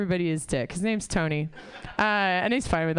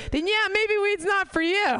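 high mock punchline; 12 semitones higher than usual. Punchline delivery, high pitch.

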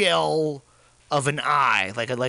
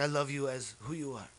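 very soft declaration of love; hushed mock reassurance; 2 semitones lower, 11 dB quieter than usual. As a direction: murmur it tenderly, play-acting. Retelling his hushed reassurance.

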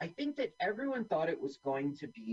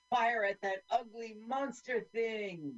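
mock soldier; 8 semitones higher, slower than usual. Voicing soldiers in film.

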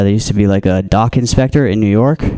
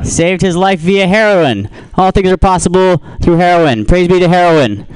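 higher, hammed-up preacher praise; a hint of preacher fervour; mock sermon; 6 semitones higher than usual. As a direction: preach heroin like a revival.

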